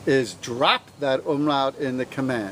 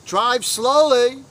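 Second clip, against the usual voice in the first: slowly drawn out, higher and louder; slow command; 7 semitones higher, 5 dB louder, much slower than usual. Shown as a demonstration. Teacher showing the class a command, drawn out. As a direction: tell them to drive slowly, slowly. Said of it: "if I wanted to say".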